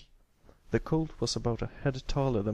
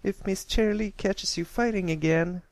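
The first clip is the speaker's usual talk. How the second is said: put-on straining young filly; 10 semitones higher than usual. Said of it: "struggling to keep a tight hold".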